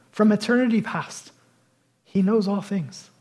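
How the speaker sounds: ordinary talk, nothing marked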